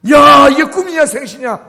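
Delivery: big theatrical burst of amazement, easing off at the end; acted astonishment. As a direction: cry out in hammed-up disbelief. Re-enacting disbelief at a miracle.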